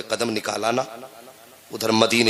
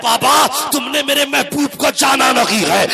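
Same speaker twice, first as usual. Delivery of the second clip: put-on high-pitched, impassioned cry of a distraught child; anguished outcry; 8 dB louder than usual. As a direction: cry it out at the top of the preacher's register. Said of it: "بچہ تڑپ جاتا ہے". (تڑپتی ہوئی بلند پکار)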